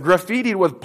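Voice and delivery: ordinary talk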